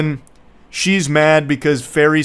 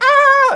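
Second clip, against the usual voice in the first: loud, very high-pitched shriek; yelped scream of a hurt child; mock pain scream; 7 dB louder than usual. Girl gets her fingers crushed in the window, hammed-up shriek.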